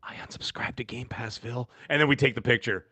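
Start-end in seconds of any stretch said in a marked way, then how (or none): 0.0-1.7 very soft, secretive murmur; pretend whisper. Guest pretending to whisper in Phil's ear, hushed. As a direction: drop right down as if into an ear. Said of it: "I'm gonna pretend I'm whispering".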